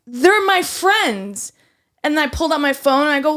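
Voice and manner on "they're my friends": high, loud and very slow, each word spelled out; slow insistence. Retold or imitated. A server insisting to a coworker, slow and emphatic. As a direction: lay it down word by word.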